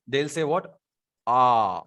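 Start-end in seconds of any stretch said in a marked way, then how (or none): 1.3-1.8 long, low British "ah" with flat tongue; R dropped the British way. Shown as a demonstration. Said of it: "if we'll talk about the Britishers".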